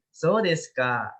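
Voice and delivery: "So desu ka?" said slowly, settling down at the end; slow, agreeing fall on "So desu ka?"; falling tune. Shown as a demonstration. Teacher demonstrating an agreeing fall to the students. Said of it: "itu turun kan?".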